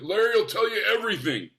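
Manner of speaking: hint of a veteran comic waving off questions; Super Dave. Repeats Super Dave's brush-off.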